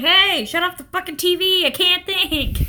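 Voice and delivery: high, loud cranky artist ghost; annoyed attic artist. Pretend. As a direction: snap at them to kill the TV. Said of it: "little artist living up there".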